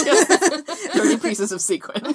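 slow laughing pun; laughing it out slowly. Real, not acted.

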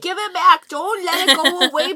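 high, loud mock outrage; mock angry. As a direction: yell it like a wronged fan.

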